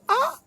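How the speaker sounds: high frustrated shriek; frustrated cry. Retold or imitated. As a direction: let out a sharp cry of despair.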